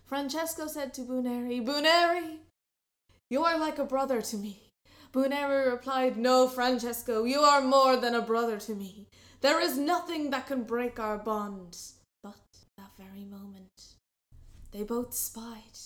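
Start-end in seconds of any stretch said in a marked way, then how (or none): 1.6-11.8 heartfelt declaration. Acted. Boys pledge brotherhood loudly.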